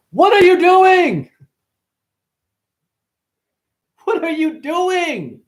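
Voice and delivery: high, loud, slow squeak; a squeaky cartoon squirrel. Voicing a squirrel to the audience, high and slow.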